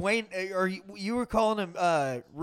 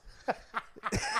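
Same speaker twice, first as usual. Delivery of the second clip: soft breathless chuckle; airy breathy laugh; 6 semitones higher, 7 dB quieter than usual. Real, not acted. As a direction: laugh quietly through the breath. Hearty laughter at a friend's mix-up.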